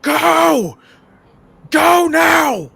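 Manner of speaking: full-force screamed command; Marosha. Acted. Marosha to companions above mid-fight, urgent scream. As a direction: scream it up the mountainside, high and loud. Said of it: "Marosha screams up".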